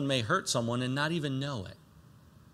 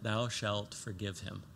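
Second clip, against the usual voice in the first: "Thou shalt forgive him" spoken softer and slowly; solemn soft command; 5 semitones lower, 10 dB quieter, slower than usual. Shown as a demonstration. Pastor stating the command as a commitment, soft and slow. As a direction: lay the command down gently and slowly. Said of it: "Stage three, it is a commitment".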